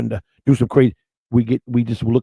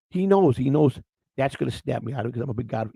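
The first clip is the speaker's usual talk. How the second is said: ordinary talk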